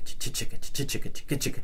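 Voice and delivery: whispered chicka chicka, soft and rhythmic; mouthed shaker sound. Shown as a demonstration. Teacher mouthing the Brazilian shaker groove, softly whispered. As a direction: breathe the shaker groove like the instrument. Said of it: "the more Brazilian chicka".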